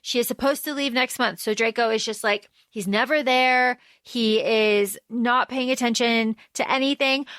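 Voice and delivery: ordinary talk, nothing marked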